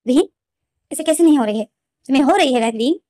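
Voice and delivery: mild imitated anger pushing back; angry insistence. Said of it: "लेनजेन को गुस्सा आ जाता है".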